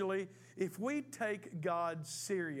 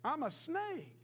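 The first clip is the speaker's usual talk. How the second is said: higher, mocking shrug of a reply; smug snake; 7 semitones higher than usual. Snake mocking the bitten boy, smug and higher. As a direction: toss it off like it's obvious.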